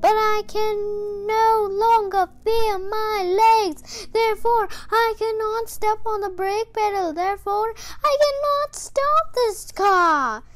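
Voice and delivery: put-on a feeble old man calling back; quavering old man. A narrator's reading. Mr Watson calling back from the runaway car.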